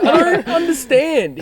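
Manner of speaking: high, slow, loud bewilderment; mock confusion. Drunk man just woken by his mate, hopelessly confused. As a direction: stretch it out in hammed-up drunken bafflement.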